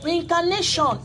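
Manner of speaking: called out high and loud; loud announcement. Real, not acted. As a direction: proclaim the word to the crowd. Announcing the topic loudly.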